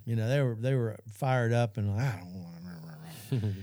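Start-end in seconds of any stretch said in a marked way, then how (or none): 2.1-3.5 low, slow, soft sulky whine; stubborn kid refusing God, low slow sulky whine; childish sulk. Retold or imitated. Feelings fired up, resisting God.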